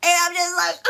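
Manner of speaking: high, helpless laughter through the words; cracking up. Real, not acted. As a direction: burst out laughing mid-sentence. Cracks up retelling the story.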